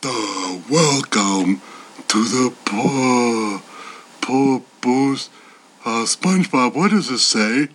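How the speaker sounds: very low, slow dopey starfish voice; Patrick Star. Retold or imitated. Patrick Star stumbling through reading the intro. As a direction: drag out low and dim like a puzzled starfish. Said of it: "a Patrick of SpongeBob reads it".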